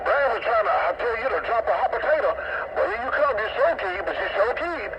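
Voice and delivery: ordinary delivery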